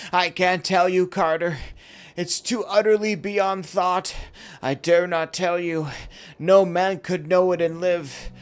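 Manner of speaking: high, loud, horror-struck pleading; fearful despair. A narrator's reading. Warren refusing to tell Carter what he sees, in fearful despair. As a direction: fear breaking into despair. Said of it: "still hoarse with fear, and now apparently tinged with despair".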